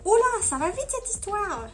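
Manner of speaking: high, mock-startled voice; high-pitched playful alarm. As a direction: back off in a high put-on voice. Acting [name]'s startled reply.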